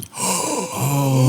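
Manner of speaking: a drawn-in gasp of shock; mock gasp. Real, not acted. Listener gasping in mock shock at the story's twist. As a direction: gasp at the twist like a soap audience.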